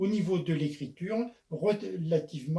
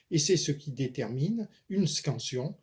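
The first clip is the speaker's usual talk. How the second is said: ordinary delivery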